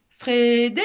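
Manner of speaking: loud, drawn-out name call; long summoning shout; cupped-hands shout. A narrator's reading. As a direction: holler through cupped hands. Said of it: "mit ses mains en cornet et cria".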